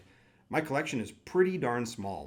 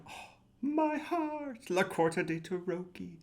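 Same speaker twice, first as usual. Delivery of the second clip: much higher and slow, melting sigh of love; swooning; 8 semitones higher, slower than usual. Real, not acted. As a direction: swoon over the deck. Swooning over a favourite deck.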